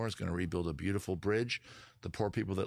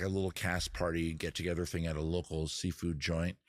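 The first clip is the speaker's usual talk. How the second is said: normal talking voice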